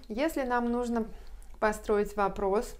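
ordinary delivery

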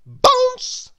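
spring the word up high; high springy bounce cry. A narrator's reading. Trips over a pebble.

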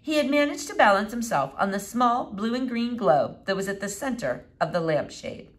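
plain reading voice

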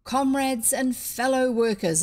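projected rally opening; voice above crowd. A narrator's reading. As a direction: address a noisy crowd, loud and commanding. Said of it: "a voice sounded above the other voices".

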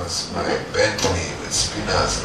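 usual speaking voice

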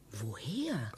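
a puzzled, whispered question. Acted. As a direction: ask it to yourself in a hush. Puzzling over a memory.

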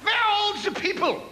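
high shrieked angry German voice; shouted German. Acted. German on the beach yelling at the pig, high mock shriek. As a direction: screech it at the pig like a furious German.

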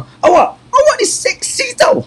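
very high, slow coy voice; flirty falsetto posing as anger; sweet mock scolding. Man voicing a wife who scolds with compliments, coy falsetto. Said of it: "Marah ni".

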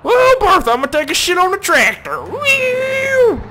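very high, loud cartoon voice; squeaky goofy character; silly high voice.

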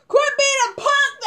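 high, drawn-out mock scolding; mocking quote. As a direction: parrot the critics in a high, slow mimic. Mockingly quotes critics' advice.

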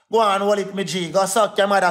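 ordinary talk

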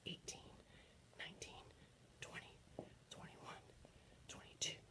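breathy whispered counting while doing push-ups. Real, not acted. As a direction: count each rep under your breath mid-exercise.

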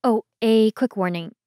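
normal talking voice

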